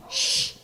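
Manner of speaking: soft conspiratorial shush; retold hushing. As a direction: imitate a secretive shush. Old man hushing, retold.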